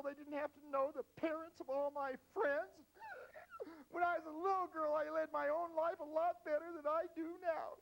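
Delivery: put-on high, loud whiny teenage girl; hammed-up high complaining teen girl. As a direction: whine like an aggrieved fifteen-year-old. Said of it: "She said".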